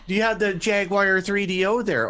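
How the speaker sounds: eager Southern customer asking for a console; Southern accent. Retold or imitated. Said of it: "like, Southern".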